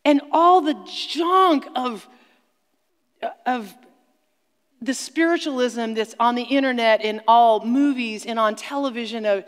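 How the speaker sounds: rally the congregation to take back the land; full-force revival preaching; fiery preaching. Acted.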